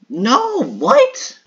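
very high, loud no, what; real high shocked shout; shocked cry. Real, not acted. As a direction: cry out in disbelief.

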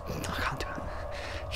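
very soft, slow whisper; hushed, slow admission of defeat; mock-defeated whisper. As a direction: whisper it as if giving up. Gives up on accent bit.

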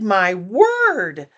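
high, amazed cry; lightly acted delighted crowd; shouted. A narrator's reading. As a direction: marvel at the sight. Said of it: "they shouted".

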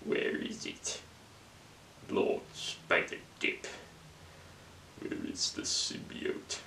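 low menacing whisper. Acted. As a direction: hiss the questions in a threatening whisper.

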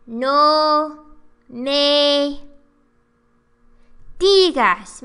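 loud, drawn-out put-on voice; acted punchline. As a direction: belt out the meme line. Meme punchline acted loudly.

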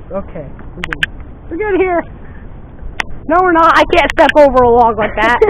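usual speaking voice

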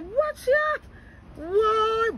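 usual speaking voice

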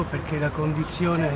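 normal talking voice

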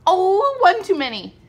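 surprised. Real, not acted. Mom reacts to miscount.

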